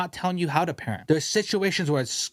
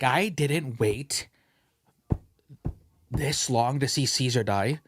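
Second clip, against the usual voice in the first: slow, dragged-out complaint; slow indignant; 3 semitones lower, slower than usual. Retold or imitated. Complaining to Emily about the noisy kid, slow and aggrieved. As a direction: stretch it out, aggrieved. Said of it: "I'm like".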